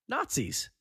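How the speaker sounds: usual speaking voice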